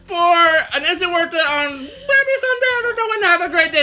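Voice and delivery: high, loud outro through sour candy; eating sour candy. Real, not acted. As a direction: shout the goodbye laughing with a sour mouth. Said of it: "keep them in our mouth as we wrap up".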